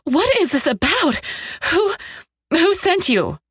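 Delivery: high, panicked demand; fighting back terror. A narrator's reading. Frightened captive demanding answers from her abductor, fighting back terror. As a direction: stammer the question out in rising fear. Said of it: "fighting back terror".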